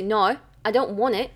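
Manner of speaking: demonstrated Australian "No"; Australian accent. Shown as a demonstration. Correcting a friend's attempt, Australian accent. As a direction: say it as an Australian would. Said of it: "No, you say".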